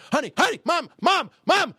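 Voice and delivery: high, sharp alarm calls; urgent calling out. Retold or imitated. Husband and kid warning wife at the wheel, urgent calling. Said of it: "frightened just by hearing that".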